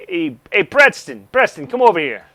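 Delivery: high, loud "hey, hey" then a call. Acted. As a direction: wave the tech over with your voice. Major calls tech over.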